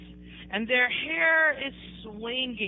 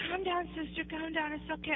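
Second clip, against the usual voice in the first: soft, higher-pitched placating voice; imitated soothing; 5 semitones higher, 8 dB quieter than usual. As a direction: mimic the sheikh hushing someone gently. Sheikh soothing an angry woman.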